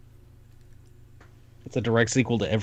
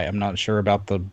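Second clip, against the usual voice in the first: usual speaking voice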